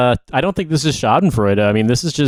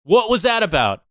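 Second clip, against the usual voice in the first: angry rant; 7 semitones higher than usual. Real, not acted. Ranting at a disgraced veteran.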